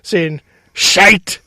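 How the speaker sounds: loud, talking through real laughter; so tickled you can barely start the sentence; laughing. Real, not acted. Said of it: "nothing makes me laugh more".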